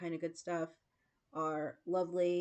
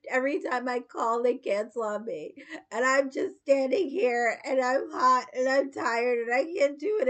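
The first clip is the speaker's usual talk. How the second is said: louder, higher, tearful pleading; a frazzled retold complaint, raised and strained; retold tearful plea; 5 semitones higher, 9 dB louder than usual. Stranded woman crying to the Uber driver, loud and tearful. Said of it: "I was like".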